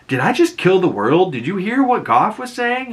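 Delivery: high, rising imitated alarm; mock panic. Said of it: "he's like".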